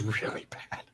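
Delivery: unvoiced, whispered confession. Real, not acted. Admitting to friends, in a whisper, it was bad. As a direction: breathe it out as a private aside.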